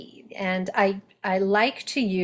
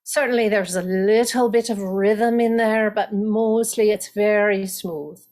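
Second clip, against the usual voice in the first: smooth, lilting Irish accent; 3 dB louder than usual. Shown as a demonstration. Coach shows Irish smoothness in an Irish accent. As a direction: talk it through in a light Irish lilt. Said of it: "but in Irish".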